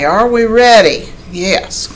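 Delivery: plain reading voice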